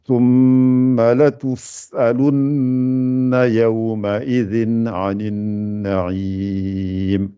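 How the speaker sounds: very slow, the sin of لتسألن pronounced crisply; clear recitation. Shown as a demonstration. Teacher showing students a clear sin, slow chant. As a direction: let the sin come out clearly, drawn out. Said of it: "que ça sorte bien aussi".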